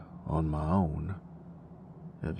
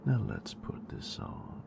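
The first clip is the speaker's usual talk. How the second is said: soft, close, teasing whisper to a lover; intimate whisper; 2 semitones lower, 7 dB quieter than usual. Acted. Teasing her nerd with lights, close intimate whisper. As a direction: whisper it close, possessive and playful.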